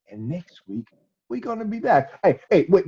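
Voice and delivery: plain reading voice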